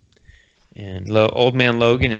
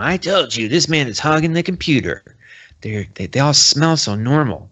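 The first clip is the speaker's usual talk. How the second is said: put-on higher, insistent old-man grumbling; crotchety old man; 4 semitones higher than usual. Old man insists to the librarian, cranky geezer voice. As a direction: complain like a paranoid old coot. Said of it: "People don't talk like that".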